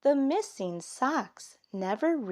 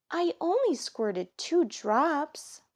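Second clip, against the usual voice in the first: put-on high defensive kid voice; child's excuse; 6 semitones higher than usual. Kit excusing himself to Mama, high and defensive. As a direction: plead innocence.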